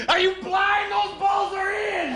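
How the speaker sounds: high, slow mock shriek of a furious tennis player; hammed-up high McEnroe yell; McEnroe tantrum. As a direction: scream at the umpire like McEnroe. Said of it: "even in bed he's going".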